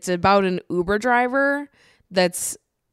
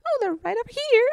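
very high squeaky Leatherface impression; mock falsetto, mimicking a movie killer; high-pitched voice. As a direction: put on an absurdly high cartoonish voice. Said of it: "speaking in this high-pitched voice".

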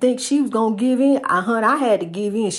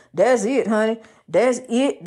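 normal talking voice

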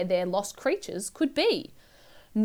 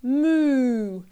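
long, loud moo gliding downward; baby's moo; 3 semitones higher, 5 dB louder than usual. A narrator's reading. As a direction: stretch MOO out like a cow. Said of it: "Then she started to moo".

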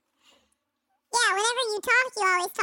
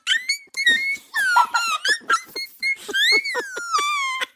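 high squealing laugh; 3 dB louder than usual. Retold or imitated. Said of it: "you're like".

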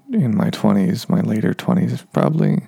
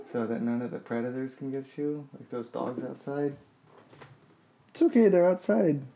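cooing to the cat; 4 semitones higher, 10 dB quieter than usual. Real, not acted. Owner soothing cat Moose about dogs outside, soft high cooing. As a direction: coo gently to reassure a nervous cat.

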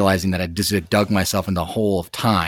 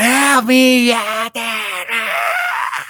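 high-pitched, acted-out scream for Dad; screamed terror crying; 3 dB louder, much slower than usual. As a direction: reenact a panicked wail for help. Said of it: "like, terror crying".

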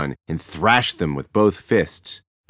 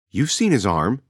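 a sharp retort, higher pitched; 6 semitones higher than usual. A narrator's reading. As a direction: snap back at the mother. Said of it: "Shishani retorted".

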